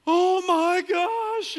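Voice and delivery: very high, loud, slow stretched squeal; ecstatic kids. Retold or imitated. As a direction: let out a long thrilled kid shriek. Kids hearing about cruise.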